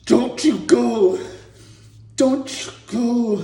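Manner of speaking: high, loud, slow plea; pleading Rocky. Retold or imitated. Rocky begging dying Apollo, slow loud plea. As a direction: beg him not to die. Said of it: "and he's like".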